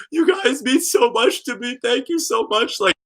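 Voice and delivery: high, tearful gushing thanks; imitated crying. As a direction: gush gratitude in a high, mock-crying voice. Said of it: "just crying and crying".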